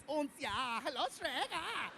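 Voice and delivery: high German-accented cartoon pig; hammed-up German piglet voice; three little pigs. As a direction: imitate the Shrek pigs' German accent. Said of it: "sound like the three little pigs".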